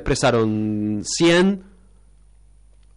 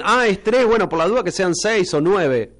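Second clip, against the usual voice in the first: higher-pitched mock quote of other people; lightly acted, higher voice for what they say; imitating others' reasoning; 8 semitones higher than usual. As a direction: act out their offhand theory, pitched up. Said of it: "Ellos dicen".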